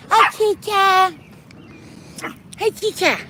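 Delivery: very high call to Kit Kat; sweet high pet call. Real, not acted. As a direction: coo the cat's name up high. Greeting cat.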